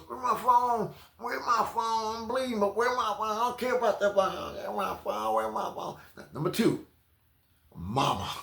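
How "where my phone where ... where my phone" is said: high whiny mock plea; high pleading whine; whiny arrestee. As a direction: nag like a bloodied fool wanting his phone. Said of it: "all you wanna know is".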